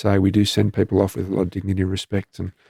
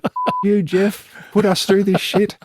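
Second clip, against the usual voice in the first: much higher, shrill-edged imitation of a widow cursing; Margie's mock yell; 12 semitones higher, slower than usual. Widow cursing her late husband in the car, imitated high yell. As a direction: squeal it in her voice, mock outrage. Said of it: "And then Margie just went".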